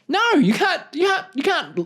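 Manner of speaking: ordinary talk, nothing marked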